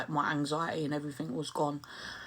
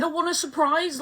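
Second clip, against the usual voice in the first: insistent; 11 semitones higher, 3 dB louder than usual. Retold or imitated. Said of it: "I said".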